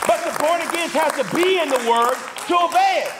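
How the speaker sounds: high, driving preacher's voice; full-force preaching. Real, not acted.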